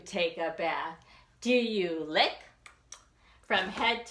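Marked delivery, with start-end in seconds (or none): none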